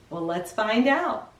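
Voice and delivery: plain reading voice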